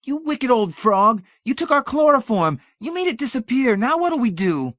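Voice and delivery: high, loud, hysterical shriek. A narrator's reading. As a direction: scream it at your mother in despair. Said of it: "and shrieked".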